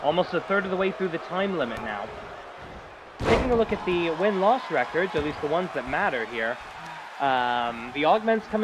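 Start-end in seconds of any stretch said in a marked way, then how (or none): none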